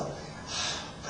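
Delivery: usual speaking voice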